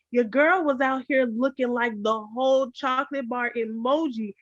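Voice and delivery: ordinary talk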